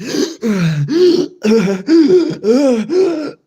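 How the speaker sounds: loud, high exaggerated gasp for air; a hammed-up wheezing gasp; mock gasp. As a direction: gasp dramatically, hyperventilating. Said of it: "you're just like".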